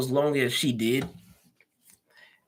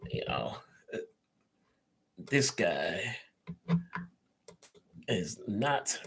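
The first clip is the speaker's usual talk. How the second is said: whispered, much softer; whispered aside; 4 semitones lower, 9 dB quieter, much slower than usual. Real, not acted. Aside while waiting for a guest.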